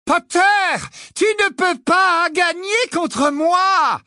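high, slow, shouted threat; shrieking witch. A narrator's reading. Bellatrix shrieking a threat at Harry. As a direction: scream it down at him. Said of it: "hurla-t-elle".

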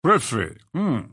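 short gruff grunt with a hum; a touch of grumbling doubt; grunted reaction. A narrator's reading. Old guard hearing about the new prefect, grunting. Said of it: "grogna Maugret".

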